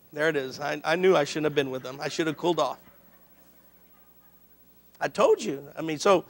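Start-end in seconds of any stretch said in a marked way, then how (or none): 0.1-2.8 low, soft grumble to oneself; hushed, low-pitched aside in mock regret; mock regretful aside. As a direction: imitate someone quietly regretting it, pitched down. Voices God regretting not cooling off.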